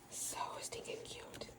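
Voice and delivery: soft breathy whisper of delight. Real, not acted. Crafter unboxing a mail swap, whispering in delight. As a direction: whisper it like a secret.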